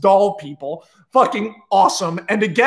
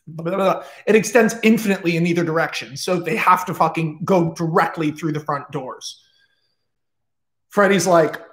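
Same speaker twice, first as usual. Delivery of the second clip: ordinary talk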